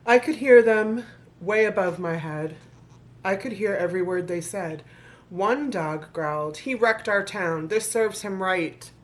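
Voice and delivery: rhythmic storybook reading to an audience; story narration. Acted. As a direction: read the rhymes like a bedtime book. Reads the picture book aloud.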